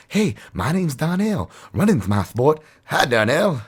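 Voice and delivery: put-on chirpy kid introducing himself to Hasselhoff; mock earnest kid voice; Donnell from Baywatch.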